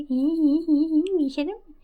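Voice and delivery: high, drawn-out mock tee-hee; mocking giggle. Mayra teases her partner for being shameless, mocking giggle.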